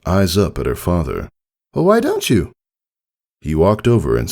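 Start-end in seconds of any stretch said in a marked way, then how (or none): 1.7-2.5 high, hopeful little filly; young filly voice. A narrator's reading. Filly asking her father to come watch, high and hopeful. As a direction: ask it like a kid hoping for a yes.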